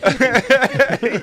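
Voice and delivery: loud high laugh. Real, not acted. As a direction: burst out laughing. Laughing at joke.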